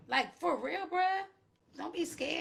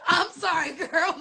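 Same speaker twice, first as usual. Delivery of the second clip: laughing through the words; 6 semitones higher, 6 dB louder, slower than usual. Pretend. Mom voicing herself, cracking up.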